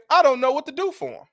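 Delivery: high, mock-helpless imitation of the mother. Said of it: "But she say".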